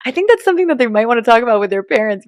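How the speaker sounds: politely smooth it over; prim deflection. Retold or imitated. Mom deflecting to the parents in front of the class.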